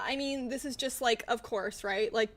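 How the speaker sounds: ordinary talk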